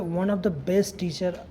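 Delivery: normal talking voice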